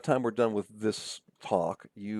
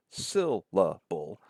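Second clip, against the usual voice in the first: SYLLABLE broken slowly into syl, la, ble; much slower than usual. Shown as a demonstration. Lecturer counting syllables for the class, slow and segmented. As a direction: say SYLLABLE in three spaced beats. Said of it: "three syllables in syllable".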